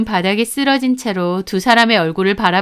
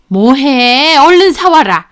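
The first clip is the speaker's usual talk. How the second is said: bossy mother-in-law, louder and higher; bossy order; 4 semitones higher, 6 dB louder, slower than usual. Acted. Mother-in-law sends her on a snack errand, bossy. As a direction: impatiently order someone off on an errand.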